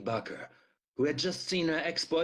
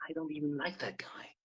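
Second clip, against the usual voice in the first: whispered, softer aside; inner thought; 7 dB quieter than usual. A narrator's reading. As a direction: breathe it out as a private thought.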